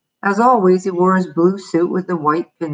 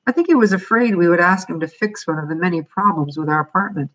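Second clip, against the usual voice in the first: ordinary delivery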